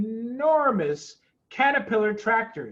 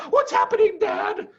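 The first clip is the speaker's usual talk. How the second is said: put-on very high, loud child's cry; frightened cry; 14 semitones higher, 6 dB louder than usual. A narrator's reading. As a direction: cry out like scared fox cubs. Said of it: "cried the small foxes".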